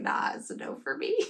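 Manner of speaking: soft, breathy half-whispered no thanks; dismissive brush-off. Retold or imitated. Smart shopper turns the candle down, soft and breathy. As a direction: wave it off under your breath. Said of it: "He was like".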